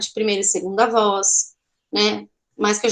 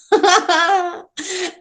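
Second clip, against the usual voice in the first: higher-pitched burst of laughter; real amused laugh; 7 semitones higher than usual. Real, not acted. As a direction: break into a spontaneous giggle. Amused by cute cri-cri instrument.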